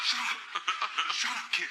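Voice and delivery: high-pitched clown snapping back; mock angry retort. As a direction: re-enact telling off a heckler. Clown snaps back at heckler.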